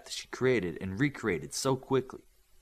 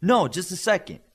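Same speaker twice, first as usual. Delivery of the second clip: raised voice calling across the room; calling back; 7 semitones higher, 7 dB louder than usual. A narrator's reading. Olympian calling back to Eclipse across tables, raised voice. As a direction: call it back over the chatter. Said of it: "called back".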